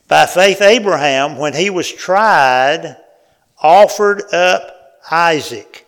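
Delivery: loud, slow, solemn reading of scripture. Acted. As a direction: proclaim the verse to the whole church. Reads Hebrews aloud to congregation.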